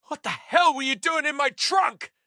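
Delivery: high, shocked outburst. A narrator's reading. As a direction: shout it in disbelief. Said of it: "Jerry's shocked".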